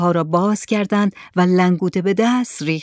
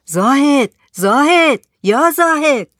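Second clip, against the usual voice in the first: high, louder calls of a name; loud calling out; 7 semitones higher, 5 dB louder, slower than usual. Acted. Villager calls for Zahed outside his hut, loud calling. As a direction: call out as if to someone inside a hut. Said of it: "با صدای بلند گفت".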